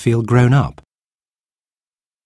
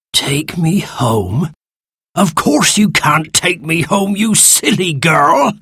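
slow incredulous question, then higher indignant scolding; grumpy creature scolding; 7 semitones higher, slower than usual. Acted. Sand creature scorns girl's idea, slow indignant grumpy voice. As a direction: start drawn-out in disbelief, then scold crossly.

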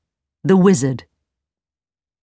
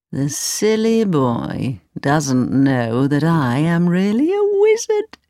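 put-on sly old wizard; slower than usual. Old man secretly mocks the boy he fooled, sly wizard. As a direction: gloat slowly to yourself, swooping up. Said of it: "the old man smiled to himself".

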